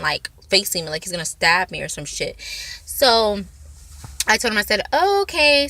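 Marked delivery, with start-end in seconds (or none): 4.9-5.7 bright fake okay. Pretending calm to stalker.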